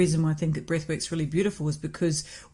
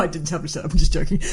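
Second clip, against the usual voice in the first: usual speaking voice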